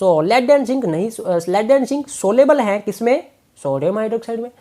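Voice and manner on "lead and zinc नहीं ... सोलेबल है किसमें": slow emphatic recall. Shown as a demonstration. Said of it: "भूलना मत".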